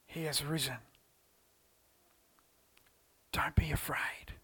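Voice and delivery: hushed whisper. Acted. Preacher whispering the angel's news to the congregation. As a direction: whisper it like a secret.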